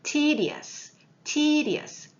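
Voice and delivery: Tedious said high, ending in a fall; model pronunciation. Shown as a demonstration. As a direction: say Tedious slowly as a model for the class. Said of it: "발음도 사실 좀 지루한 것 같아요".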